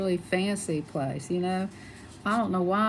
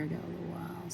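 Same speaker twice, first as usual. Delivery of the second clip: whisper; 11 dB quieter, faster than usual. Real, not acted. Quiet aside while painting.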